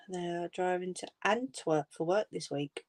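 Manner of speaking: whisper. Real, not acted. Quiet aside reading.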